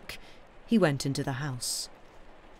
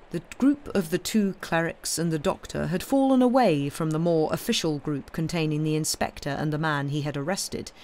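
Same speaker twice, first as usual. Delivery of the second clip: ordinary delivery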